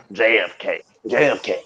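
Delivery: usual speaking voice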